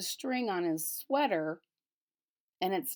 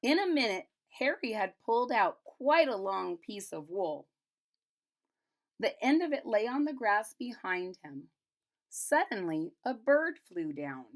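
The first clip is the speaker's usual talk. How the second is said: put-on reading to children; 2 semitones higher, 4 dB louder than usual. A narrator's reading. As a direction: read it aloud like a picture book for kids. Reads picture book aloud.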